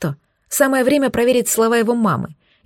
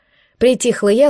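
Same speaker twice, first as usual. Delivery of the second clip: plain reading voice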